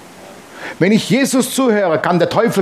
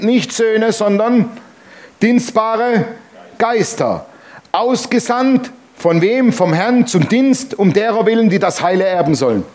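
slow, stressed phrase by phrase with pauses before dienstbare and Geister; preacher's deliberate stress, dienstbare Geister set apart; emphatic reading; 3 semitones higher than usual. Shown as a demonstration. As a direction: lean on dienstbare, then ask von wem slowly with a rise. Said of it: "jetzt kommt nochmal die Betonung".

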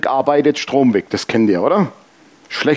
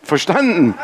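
normal talking voice

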